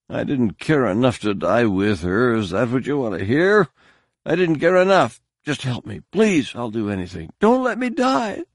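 rising, desperate begging, highest at the end; pleading. Acted. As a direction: beg for life as it slips away. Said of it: "Kunra's pleading became fragmented".

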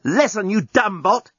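angry. Acted. Journalist insulting bot.

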